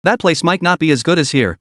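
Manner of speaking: ordinary delivery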